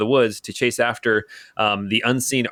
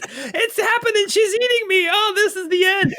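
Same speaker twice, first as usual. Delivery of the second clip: very high, hammed-up goblin wail; terrified gobloid. Gobloid sure the warrior is eating him, terrified. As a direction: shriek it like a doomed little goblin. Gobloid believes he is being eaten.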